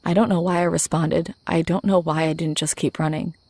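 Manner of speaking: plain reading voice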